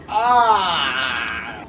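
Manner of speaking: drawn-out, low groan of defeat; long low groan. Real, not acted. Player done for dinner, groaning in defeat. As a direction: let out a long, sinking groan.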